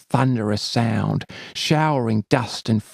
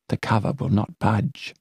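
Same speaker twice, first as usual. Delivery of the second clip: usual speaking voice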